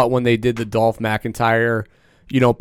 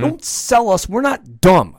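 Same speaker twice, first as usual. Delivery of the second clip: indignant rant; 8 semitones higher, slower than usual. Real, not acted. Ranting at Goldberg's speech.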